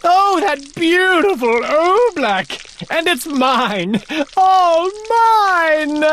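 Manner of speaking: king gloating over his oobleck. Acted. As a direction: savor every word like treasure.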